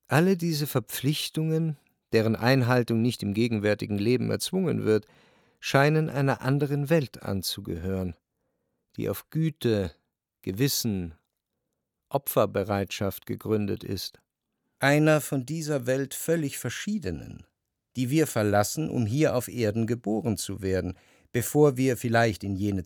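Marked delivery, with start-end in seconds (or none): none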